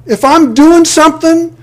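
high, loud insistent rebuttal; emphatic rebuttal. Acted. Preacher rebutting the indifferent believer, high and loud insistent. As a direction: fire back at the objection, high and loud.

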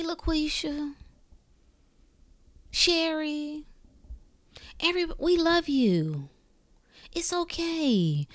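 mock coddling voice. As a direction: coo at them like a fake wellness guru. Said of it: "they wanna convince you that".

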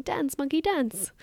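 excited exclaiming. Acted. Coach playfully egging on the instant gratification monkey, excited. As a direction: exclaim it with delight, pitch lifted high. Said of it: "clapping your hands together excitedly and exclaiming".

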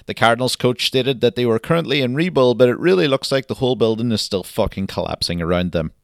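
loud, punchy newsreader delivery; news anchor voice. Acted. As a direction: read the headlines like a TV anchor. Spoof news headlines read.

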